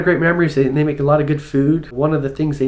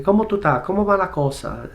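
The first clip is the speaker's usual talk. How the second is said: "¿Cómo tú estás?" said higher, the s sounds dropped; Caribbean Spanish; 5 semitones higher, faster than usual. Shown as a demonstration. Visitor imitating Puerto Rican greetings, a lightly done Caribbean accent. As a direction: swallow the s's, Caribbean lilt. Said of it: "they don't pronounce their S's".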